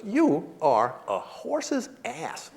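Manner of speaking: very slow, high, soft verdict; deliberate insult. Retold or imitated. Wife passes verdict on husband, slow and deliberate. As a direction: pronounce it one word at a time.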